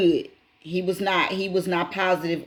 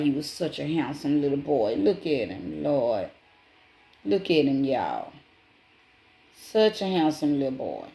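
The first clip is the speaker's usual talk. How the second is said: tender; 4 semitones lower than usual. Real, not acted. Tender over photo of boy.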